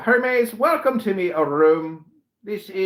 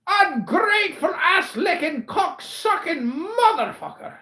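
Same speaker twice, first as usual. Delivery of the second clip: usual speaking voice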